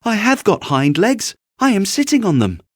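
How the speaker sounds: high, loud, hurried insistence; little rabbit insisting. Acted. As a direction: blurt it out to be believed. Little rabbit defending himself.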